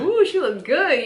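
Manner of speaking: high, swooping mock "ooh"; imitated admiration. Voicing admirers of her tan.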